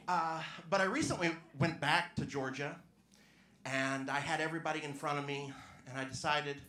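ordinary talk, nothing marked

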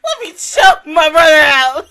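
loud tearful sob-shouting, voice cracking high; crying out loud through the words; real crying. Real, not acted. Overcome by an anime scene, crying loudly to viewers. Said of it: "and you got me to cry".

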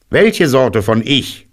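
high, slow questioning rabbit; rabbit character. A narrator's reading. Rabbit checks who is outside, high rabbit voice. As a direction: draw out a high-voiced query.

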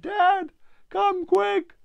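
high, loud mock call for help; calling for dad. Girl calling her dad from the clearing, high loud shout. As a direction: yell out for Dad, half-pretend.

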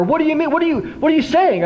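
high, fast disbelieving challenge; incredulous friends. Retold or imitated. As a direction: fire back in disbelief.

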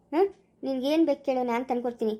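usual speaking voice

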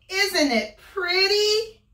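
put-on high, loud, slow old woman; drawn-out granny call. Granny calling the wolf inside.

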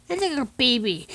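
normal talking voice

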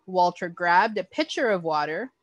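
usual speaking voice

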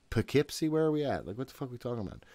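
ordinary talk, nothing marked

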